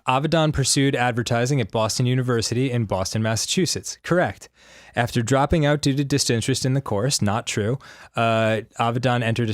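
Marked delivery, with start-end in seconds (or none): none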